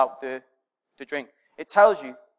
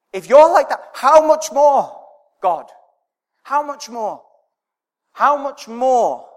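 higher and louder, slow on how much more; passionate preaching; 8 semitones higher, 8 dB louder than usual. Retold or imitated. Les urging the church, passionately preaching. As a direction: stretch out how much more, pleading with wonder. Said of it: "Les was trying to say yesterday".

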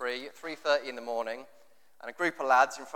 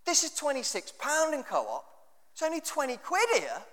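higher, mocking lads' banter voice; higher imitation of a lad bragging about a bargain; lad at the airport; 9 semitones higher than usual. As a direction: take off an excited lad spotting cheap vodka. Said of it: "and this lad says".

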